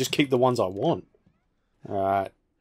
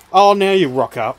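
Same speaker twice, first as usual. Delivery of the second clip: loud; 6 semitones higher, 9 dB louder than usual. Real, not acted. Annoyed at an enemy arriving late.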